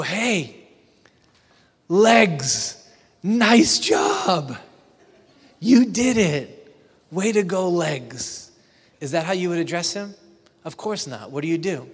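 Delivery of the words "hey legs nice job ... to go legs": loud, high cheer at his legs; mock hearty congratulations. To the runner's legs, a loud mock cheer. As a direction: shout praise at a runner's legs. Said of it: "Do you go up to him at the end and go".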